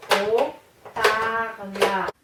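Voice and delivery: loud, slow, separated syllables. Shown as a demonstration. Teacher confirming three syllables, syllable by syllable. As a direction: stretch "Haut-parleur" into distinct beats. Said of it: "J'en ai combien de syllabes".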